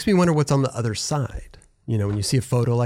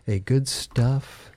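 ordinary talk

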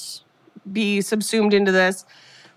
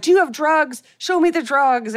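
accusing dad; 6 semitones higher than usual. Retold or imitated. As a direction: bark it at your kid. Dad searching son's room.

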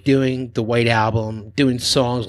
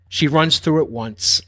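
normal talking voice

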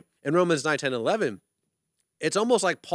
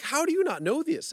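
sharply higher, disbelieving question; incredulous; 13 semitones higher than usual. Retold or imitated. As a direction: toss it out in amazed disbelief. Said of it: "I was like".

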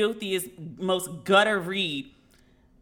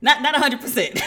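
laughing; 6 semitones higher, 6 dB louder than usual. Real, not acted. Laughing at dad's verdict.

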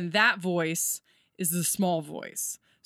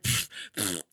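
low puffed "pfft, pfft"; dismissive raspberry; 10 semitones lower, 3 dB quieter than usual. Retold or imitated. Speaker mimics everyone's letdown at the Mayan calendar flop, dismissive puffing. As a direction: let out a deflating puff of air.

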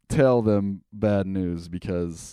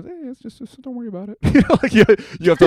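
fake casual reassurance; 10 semitones higher, 7 dB louder than usual. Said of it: "sell it to my family like it's no big thing".

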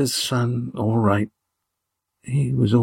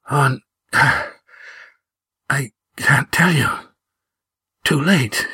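higher, broken fragments with long pauses; struggling for breath; 4 semitones higher than usual. A narrator's reading. Wounded Gar trying to give friends directions, struggling for breath. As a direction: labour out each few words, breath failing. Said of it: "his breath bubbling in his throat as he tried to talk".

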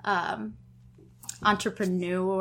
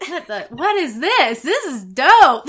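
amazed; 4 semitones higher, 11 dB louder than usual. Retold or imitated. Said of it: "So I was like".

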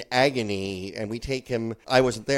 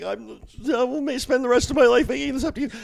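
high-pitched, louder wailing apology; hammed-up tearful remorse; mock crying; 13 semitones higher, 5 dB louder, faster than usual.

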